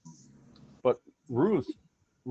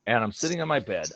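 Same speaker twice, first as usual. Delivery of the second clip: ordinary delivery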